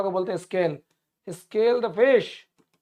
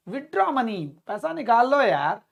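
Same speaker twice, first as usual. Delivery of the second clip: ordinary talk, nothing marked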